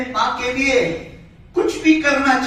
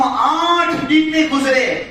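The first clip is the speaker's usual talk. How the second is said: drawn-out, louder preaching line; loud slow emphasis; 5 semitones higher, 5 dB louder, slower than usual. Acted. Preacher tells congregation how fast his mother died, loud and slow. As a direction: hammer home how few days it was.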